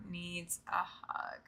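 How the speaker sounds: slow, breathy whisper just for Eeyore. Pretend. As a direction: lean in and whisper it slowly, like a secret. Tender aside about Eeyore.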